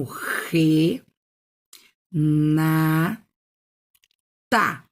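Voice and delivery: "re, na, ta" drawn out with long gaps; sound by sound. Shown as a demonstration. Teacher showing three sounds in a name, "re, na, ta" spaced far apart. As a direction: hold each of "re", "na", "ta" as a separate sound. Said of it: "São três sons".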